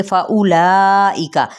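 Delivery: very slow, drawn-out chant of फउलाइका; tajweed demo of फउलाइका; recited word. Shown as a demonstration. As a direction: hold every vowel of फउलाइका in recitation tune. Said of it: "फा पे भी hums है".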